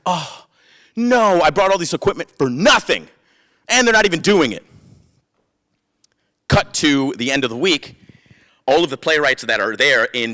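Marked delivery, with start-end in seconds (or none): 0.0-4.6 high-pitched, hammed-up lament; mock dismay. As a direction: whine it up high in comic despair. Replays his dismay at unused equipment.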